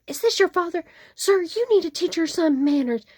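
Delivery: mock screaming Karen. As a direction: ham up an irate customer scolding. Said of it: "she starts screaming".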